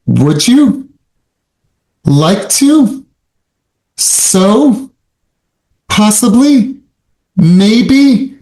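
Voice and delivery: each phrase lifting up at the end, unsure; upswing tonality. Shown as a demonstration. Coach demonstrating weak upswing to agents, rising and unsure. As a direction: ask timidly, pitch rising on every ending. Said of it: "That's an upswing".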